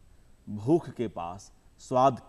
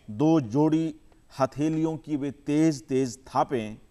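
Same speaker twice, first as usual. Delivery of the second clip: measured recital with long pauses between phrases; poem recitation; 6 dB louder than usual. Acted. Poet reciting his poem to the audience, measured and unhurried. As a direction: savour each image, let the lines breathe.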